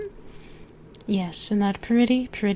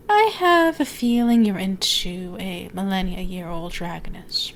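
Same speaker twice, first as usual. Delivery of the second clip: normal talking voice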